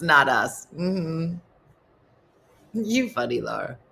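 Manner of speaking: ordinary delivery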